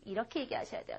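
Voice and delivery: normal talking voice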